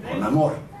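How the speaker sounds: normal talking voice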